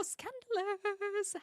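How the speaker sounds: slow high gasping Scandalous; drawn-out mock shock; mock scandalized.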